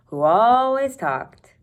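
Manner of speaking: "who always talked" slowed, always lifted high and loud; stress on always. A narrator's reading. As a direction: lean hard on "always", drawn out.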